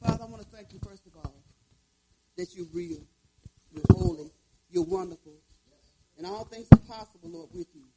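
prayer. Acted. Opening prayer.

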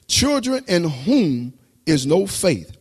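normal talking voice

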